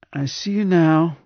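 high, slow reedy patient; reedy sighing voice. A narrator's reading. Patient tells his doctor he can see him now, reedy sighing voice. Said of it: "sighed Roger in his reedy voice".

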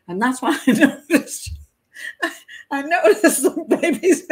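words squeezed out through high laughter; fighting the giggles to read on; laughing while reading. Real, not acted. Host reading the funeral home's statement, laughing. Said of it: "I'm only laughing because".

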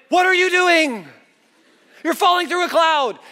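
high-pitched mock panic; alarmed inner voice. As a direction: cry out in disbelief, way up high. His panicked brain mid-fall.

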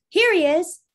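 high, loud call. A narrator's reading. Young man to the searching children, calling out loudly. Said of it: "the young man called".

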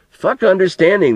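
ordinary delivery, nothing marked

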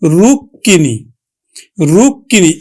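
রুক্মিণী as rukkini, with the double k pressed; teacher repeating রুক্মিণী; pronunciation demo. Shown as a demonstration. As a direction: say রুক্মিণী twice, clearly doubling the k. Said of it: "উচ্চারণ করলাম কিন্তু".

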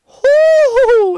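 loud, high, slow hoots; real relieved hoots; high hooting. Real, not acted. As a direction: hoot with relief at a near miss. Near miss relief.

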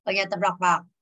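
ordinary delivery

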